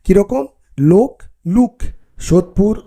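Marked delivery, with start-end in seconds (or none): 0.8-1.8 লোক then লুক, the o closing to u; o raised to u. Shown as a demonstration. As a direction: say লুক with a rounded u. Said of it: "এরকমভাবে উচ্চারিত হয়".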